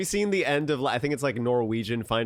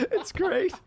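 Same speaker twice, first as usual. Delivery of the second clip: high laughing squeal; cracking up laughing; 3 dB quieter than usual. Real, not acted. Laughing hard at the joke.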